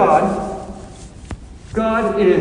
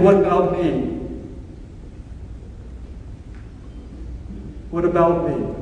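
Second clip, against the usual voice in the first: God pleading; 4 semitones lower, 8 dB quieter than usual. Retold or imitated. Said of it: "God's over there in the corner saying".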